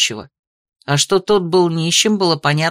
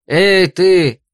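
firm, mildly raised hail; loud hail; 4 dB louder than usual. A narrator's reading. Carter hailing a man in the road, loud hail. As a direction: hail him firmly, without a full shout. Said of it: "Громко окликнул он мужчину".

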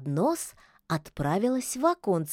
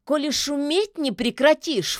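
higher, huffy witch's retort; higher-pitched dismissive scoff; scornful snort; 7 semitones higher, 4 dB louder than usual. A narrator's reading. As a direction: snort it back, unimpressed. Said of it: "фыркнула Яга".

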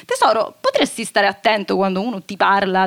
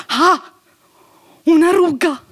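sharp higher cry of dismay; horrified gasp; 6 semitones higher, 4 dB louder, slower than usual. Acted. Mother cries out on finding a wrinkle in the mirror. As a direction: recoil at the mirror.

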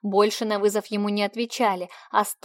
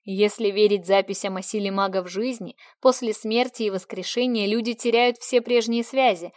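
usual speaking voice

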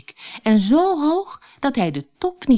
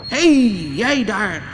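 shouting troll; 3 dB louder than usual. A narrator's reading. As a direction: call out sharply to an intruder, stretching the words. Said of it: "schreeuwde de trol". (langgerekte roep van de trol)

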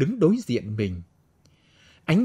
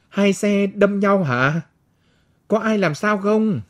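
higher, louder calling out; concerned passerby; 7 semitones higher, 3 dB louder than usual. A narrator's reading. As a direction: call out to the scene. Passerby calls out at crash.

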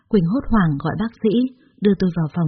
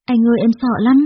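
scared, higher and louder; cling to him and plead; frightened cry; 4 semitones higher, 5 dB louder than usual. A narrator's reading. Girlfriend hugging boyfriend at hospital, frightened.

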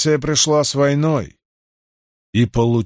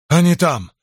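urgent gasped report; breathless exclaim; 5 semitones higher, 4 dB louder than usual. Acted. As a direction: blurt it out breathlessly. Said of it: "выдохнул разведчик".

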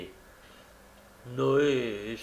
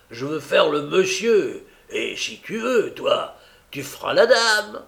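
swaggering boast; 5 semitones higher, 6 dB louder than usual. A narrator's reading. Noah boasting to Charlotte.

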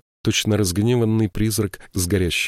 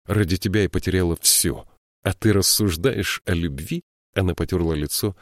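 usual speaking voice

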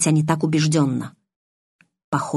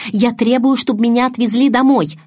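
громко и настойчиво; требуй, повысив голос; требование; 7 semitones higher, 5 dB louder than usual. A narrator's reading. Белла требует отвезти домой. (громкое требование Беллы)

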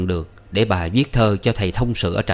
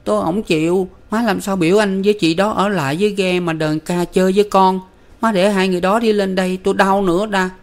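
put-on crying little boy; 11 semitones higher than usual. A narrator's reading. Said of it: "vừa khóc vừa nói".

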